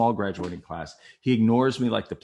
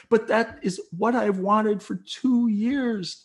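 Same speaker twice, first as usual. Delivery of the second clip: high, drawn-out desperate plea; tight-throated; 12 semitones higher, much slower than usual. Retold or imitated. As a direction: force it slowly through a closing throat. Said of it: "your throat gets tight".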